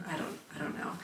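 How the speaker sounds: breathy, sore whisper. Real, not acted. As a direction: groan it out, exhausted. Sore after pole class, groaning.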